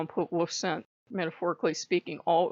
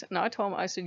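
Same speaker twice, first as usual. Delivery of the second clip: ordinary talk, nothing marked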